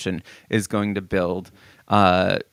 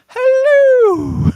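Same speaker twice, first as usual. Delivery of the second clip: very high, loud sung-out hello; Mrs Doubtfire hello; 12 dB louder than usual. Retold or imitated. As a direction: belt it like Mrs Doubtfire at the fridge. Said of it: "Robin Williams ... he's like".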